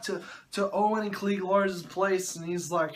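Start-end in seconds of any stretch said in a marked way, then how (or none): none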